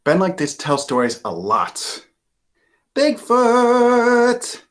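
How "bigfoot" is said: high, loud drawn-out yell; boy yelling down the hill, high and loud. Acted. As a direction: call it down the hill. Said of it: "he yells down the hill".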